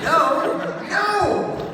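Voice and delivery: loud, high-pitched "No!"; panicked shout. Retold or imitated. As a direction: cry out in terror, playing it for laughs. Reliving shark fear on stage.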